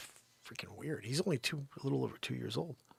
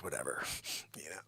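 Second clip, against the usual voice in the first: ordinary talk, nothing marked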